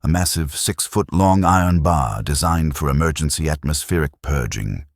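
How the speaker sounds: ordinary delivery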